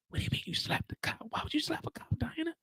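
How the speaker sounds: urgent hushed whisper. Retold or imitated. Drew pleading with Diana from jail, whispering. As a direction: whisper fast, trying to stop her.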